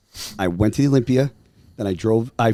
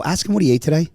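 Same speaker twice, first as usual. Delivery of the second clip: raised-pitch retold question; just like this; 6 semitones higher, faster than usual. Son asking mom about dad on the phone, urgent imitation. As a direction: ask it higher, imitating yourself. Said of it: "just like this".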